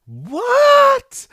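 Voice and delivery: very high, loud drawn-out "what"; shocked squeal. Retold or imitated. Go spotting the Grookey, a high shocked shriek. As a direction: shriek it like a cartoon double take.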